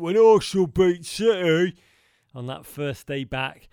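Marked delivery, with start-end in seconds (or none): 0.0-1.7 loud, mocking gloat on Arsenal; gloating mate. Mimicking his gloating Arsenal mate, loud mock crowing. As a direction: crow it like a smug rival fan. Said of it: "or as he said".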